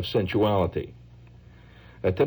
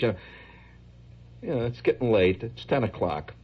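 weary partygoer; 8 semitones higher than usual. Retold or imitated. Tired partygoers winding the night down.

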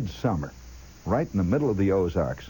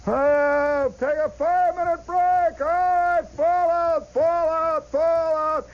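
much higher, drawn-out calls passed down the column; sergeant calling break; 3 dB louder, slower than usual. Retold or imitated. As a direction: sing out the break order like a sergeant far up the line. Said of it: "You'd hear this guy".

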